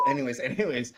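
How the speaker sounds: normal talking voice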